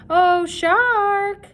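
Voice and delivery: high, loud mocking call. A narrator's reading. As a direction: call through cupped hands. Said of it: "called through cupped hands".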